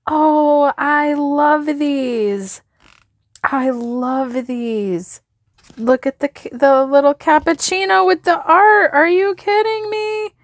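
real giddy delight; delighted gushing. Real, not acted. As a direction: swoon over it, then rise in disbelief. Gushing over new washi.

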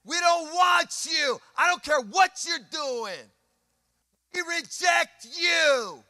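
high, slow jeering crowd shout; rejecting crowd. Retold or imitated. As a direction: hurl rejection like a mob. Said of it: "It means to reject".

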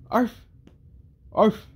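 high, short imitation dog yip; high-pitched bark. Reader voicing the dog Akamaru, high-pitched bark. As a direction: yip "arf" like a small dog. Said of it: "I did the high-pitched one".

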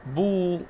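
بو held high as "buu"; long vowel. Shown as a demonstration. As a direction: draw out the u of بو. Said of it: "واو قبلها ضمة".

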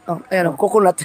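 normal talking voice

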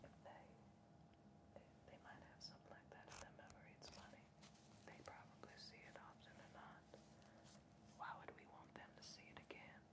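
soft unvoiced whisper, close and intimate; hushed soothing whisper; ASMR whisper. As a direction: breathe it like an ASMR video. Long hushed hypnotic whispering.

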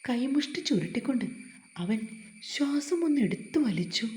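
ordinary delivery

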